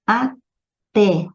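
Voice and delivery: "Até" separado, com o TÉ forte; última sílaba tônica. Shown as a demonstration. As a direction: pause no meio de "Até" e pese no TÉ. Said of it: "última sílaba". ("Até" em duas sílabas, destacando a final)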